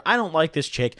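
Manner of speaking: usual speaking voice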